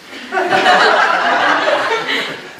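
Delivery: breathy burst of real laughter; a real high cackle; high unvoiced laugh. Real, not acted. Laughs at own story.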